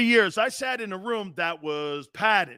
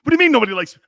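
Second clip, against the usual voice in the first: louder, higher whiny take on a quarterback; Baker Mayfield impression; 5 semitones higher, 10 dB louder, much faster than usual. A host mocking a quarterback, whiny and loud. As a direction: play the hurt star quarterback, loud. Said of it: "I sound like Baker Mayfield now".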